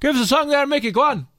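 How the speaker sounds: a rowdy crowd urging Mickey to sing; mock pub crowd. Voices pub crowd asking song.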